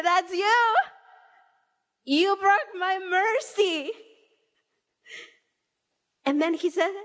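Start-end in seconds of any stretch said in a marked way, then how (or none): 0.0-3.9 higher, slow, tearful and pained; tearful accusation. Retold or imitated. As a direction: accuse God through rising tears, slowly. Retelling accusing God, close to tears.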